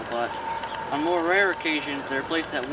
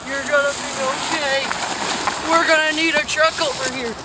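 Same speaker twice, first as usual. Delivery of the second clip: high urgent battlefield shouting; man down shout; 10 semitones higher, 5 dB louder than usual. Acted. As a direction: yell for help under fire. Flashback of wounded soldier.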